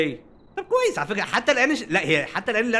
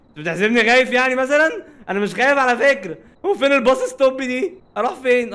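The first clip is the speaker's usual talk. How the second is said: louder and higher, protesting he isn't scared; defensive outburst; 6 semitones higher, 5 dB louder than usual. Real, not acted. Gamer insisting to the game he's not scared, loud and defensive. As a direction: bluster to prove you're not afraid.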